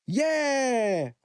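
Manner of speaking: high cheering shout; the girls shouting agreement. A narrator's reading. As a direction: yell yes together. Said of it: "råbte alle pigerne".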